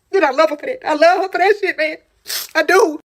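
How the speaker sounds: real tears of gratitude pushing through; emotional gratitude. Real, not acted. Thanking her sister to viewers, voice breaking.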